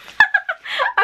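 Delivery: very high squealed giggle; shrieking laugh. Real, not acted. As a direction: burst into a high surprised laugh. Surprised by hidden item.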